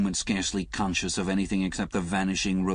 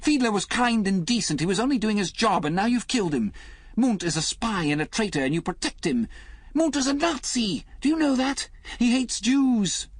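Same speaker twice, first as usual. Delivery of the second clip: much higher and louder, furious shout; 5 dB louder than usual. A narrator's reading. Liz accusing Alec of protecting Munt, shouting. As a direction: rail at him in outrage.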